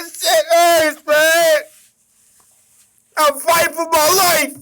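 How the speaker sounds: a touch of tearful desperation; distraught lament. Real, not acted. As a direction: plead like a heartbroken fan. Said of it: "I'm about to cry".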